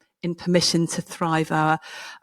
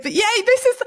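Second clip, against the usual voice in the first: very high, gleeful self-mocking boast; mock triumph; 12 semitones higher, 3 dB louder than usual. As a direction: celebrate it in a squeal that tips into laughter. Mock triumph over being mediocre.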